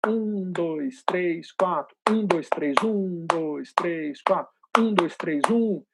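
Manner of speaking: looping count of four long beats and three quick ones; repeated rhythmic count. Shown as a demonstration. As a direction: chant in strict time, doubling speed on each group of three. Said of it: "vou ficar repetindo só essa estrutura".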